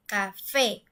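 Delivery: café slowed, "ca" then a long, strong "fé"; stress on last syllable. Shown as a demonstration. Teacher showing café as we say it, final syllable stressed. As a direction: lean hard on the "fé" in café. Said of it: "se escucha mucho más fuerte".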